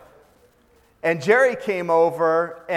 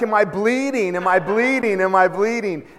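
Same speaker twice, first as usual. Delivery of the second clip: higher, louder panicked repeats; mock panic; 4 semitones higher, 4 dB louder than usual. Boy running round the yard asking if he's bleeding, mock panic. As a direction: play a boy asking in a fright, hammed up. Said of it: "he's like".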